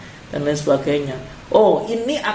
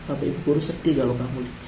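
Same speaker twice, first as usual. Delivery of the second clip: put-on soft, tender voice to a crying child; gentle comforting; 3 semitones lower, 6 dB quieter, faster than usual. Teacher gently comforting a sad pupil who arrives crying, soft and tender. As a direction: softly console the sad pupil. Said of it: "Simpati dengan dia".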